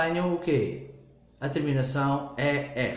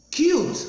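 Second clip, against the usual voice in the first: "Cute" said high in a single short beat; one-beat demonstration; 11 semitones higher, 4 dB louder than usual. Shown as a demonstration. Teacher showing one syllable, "Cute" in one high beat. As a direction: punch "Cute" out high, all at once. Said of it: "eu só digo uma vez".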